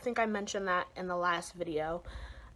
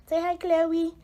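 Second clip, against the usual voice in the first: high, loud pet-greeting voice; sudden high doggy-talk voice; talking to her dog; 10 semitones higher, 8 dB louder than usual. Real, not acted. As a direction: coo brightly to your dog, pitched up and louder. Calling her dog to the camera.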